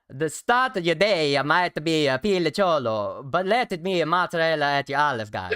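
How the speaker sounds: a hammed-up Marlon Brando Godfather impression in an Italian accent. Streamer reads the diary line to his co-host in a mock Italian accent. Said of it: "in your best Italian accent".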